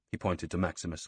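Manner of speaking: plain reading voice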